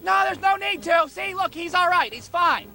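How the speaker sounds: put-on high, hasty kid backpedalling; imitated anxious-to-placate kid; pleading reassurance. Kid hurriedly reassuring angry adult.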